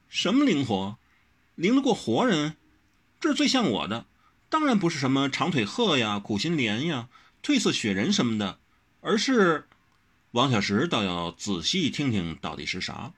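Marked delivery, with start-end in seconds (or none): 0.1-9.7 higher, scornful retort; scoffing. A narrator's reading. Wen Rou scoffing at Wang Xiaoshi, higher. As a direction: spit the words back at him. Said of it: "温柔啐道".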